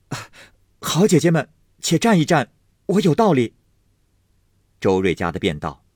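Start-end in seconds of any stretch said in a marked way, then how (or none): 0.1-3.5 eager plea. A narrator's reading. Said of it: "宝玉笑道".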